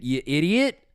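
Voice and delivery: usual speaking voice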